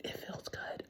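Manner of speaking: whispered confession, voice gone unvoiced; secret whisper. Real, not acted. Whispering to her viewers that cursing feels good.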